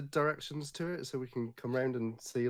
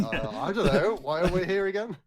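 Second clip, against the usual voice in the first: louder dithering, clueless voice; Tweedledum; 5 semitones higher, 8 dB louder than usual. Acted. Player mimics the clueless pair by Tweedledee and Tweedledum, dithering. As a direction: play up the dumbfounded confusion. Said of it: "we're just like Tweedledee and Tweedledum".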